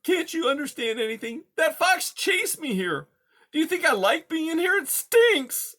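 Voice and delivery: tiny timid mouse voice, high; timid little mouse. Acted. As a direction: squeak it out like a scared mouse. Poppy speaking.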